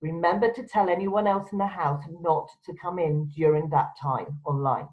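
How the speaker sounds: ordinary talk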